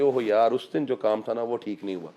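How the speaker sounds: a hint of brooding over a bad day; mock regret. Speaker imitates someone still regretting old criticism, softly mock glum. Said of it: "उसी बात को लेके फिरते रहेंगे".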